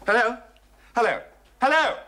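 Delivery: impatient telephone hellos; calling down the line. Acted. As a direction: call hello to check someone is there. Calling into a dead phone line.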